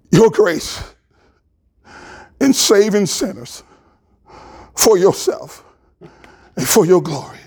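pastor closing in humble prayer; heartfelt prayer. Real, not acted. Pastor prays aloud to God.

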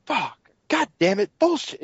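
muttered-level mock frustration, no yell; mild retold cursing. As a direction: lightly re-enact a gamer's curse. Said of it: "I'm usually like".

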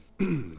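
short preparatory throat clear. Acted. Human turning to answer a newcomer, clearing his throat. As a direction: clear your throat before addressing a stranger. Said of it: "clears his throat".

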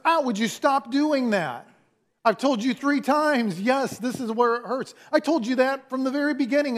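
high, whiny exasperated complaint; a hurt boy fed up with being prodded; whining at the nurse who keeps poking; exasperated kid. Retold or imitated.

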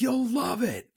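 high-pitched mock enthusiasm; put-on excited endorsement; imitated gushing recommendation. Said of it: "where I'll be like".

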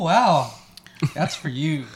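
ordinary delivery

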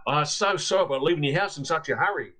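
put-on apologetic, lightly done Australian accent. Australian guest apologises to the landlady by phone, light Australian accent. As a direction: say sorry in an easy Australian voice. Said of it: "from the same Australian".

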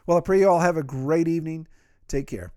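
ordinary delivery

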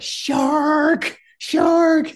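high, loud yell of alarm; alarmed shout. Retold or imitated. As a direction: scream the warning to the men in the water. Crewman spots shark, warns swimmers.

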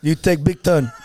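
lightly put-on Brazilian accent. Teller imitates the husband blaming him, shaky attempt at Brazilian accent. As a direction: try a rough Brazilian accent. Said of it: "that was my Brazilian voice".

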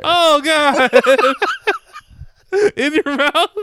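real laughter breaking into the words; laughing disbelief. Real, not acted. Hearing that his friend held vomit in his mouth, laughing. Listener cracking up at story.

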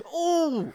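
mock wail.

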